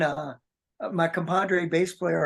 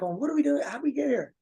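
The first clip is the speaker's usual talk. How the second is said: higher-pitched mock panic; retold fluster; 7 semitones higher than usual. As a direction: re-enact flustered questions in a raised pitch. Retold panic at missing the plane.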